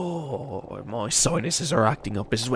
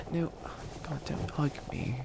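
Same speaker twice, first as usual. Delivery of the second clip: flustered mutter; 10 dB quieter than usual. Acted. Captive hugs the villain.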